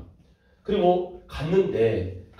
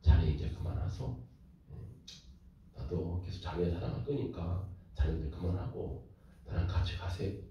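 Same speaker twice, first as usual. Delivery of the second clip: much lower and softer, gentle plea; tender Jeolla dialect; 9 semitones lower, 11 dB quieter than usual. Retold or imitated. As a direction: soothe her home in Jeolla dialect. Husband gently calling wife home. (아주 낮고 부드러운 전라도 사투리로 달래듯)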